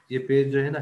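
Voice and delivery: ordinary talk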